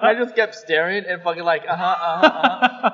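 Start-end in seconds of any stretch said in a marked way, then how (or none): 1.7-2.9 nod along mockingly, half laughing; mock agreeing. Staring back at the road rager, mock agreeing.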